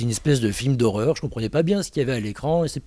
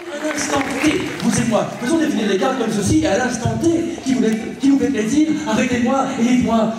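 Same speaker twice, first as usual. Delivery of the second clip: eccentric stage magician; 10 semitones higher than usual. Acted. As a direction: ham it up for the crowd like a crazy showman. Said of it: "magicien excentrique et déjanté".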